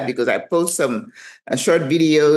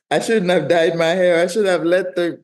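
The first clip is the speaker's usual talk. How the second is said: mock crying plea; 2 semitones higher than usual. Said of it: "This is where I'm like".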